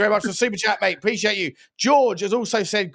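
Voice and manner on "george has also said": normal talking voice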